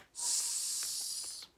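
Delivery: long breathy hiss; snake hiss. A narrator's reading.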